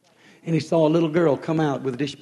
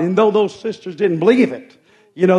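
ordinary talk

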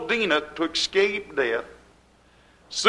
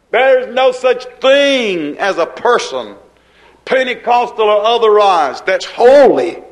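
preaching climax; 3 semitones higher, 3 dB louder than usual. Acted. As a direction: hammer it home to the congregation.

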